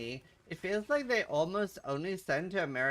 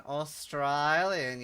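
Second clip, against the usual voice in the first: AUSTRALIAN drawn out slowly in broad Australian; Australian accent; 4 dB louder, much slower than usual. Shown as a demonstration. Streamer answering chat's doubt, exaggerated Australian accent. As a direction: stretch AUSTRALIAN with a broad Aussie middle vowel. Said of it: "Sounded more Australian?".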